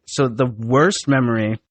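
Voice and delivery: usual speaking voice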